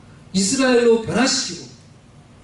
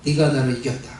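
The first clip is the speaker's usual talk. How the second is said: lower, gentle voice of God; a lightly lowered divine voice; God speaking; 5 semitones lower than usual. Acted. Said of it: "하나님이 이렇게 말씀하시는 거예요".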